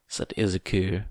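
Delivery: ordinary delivery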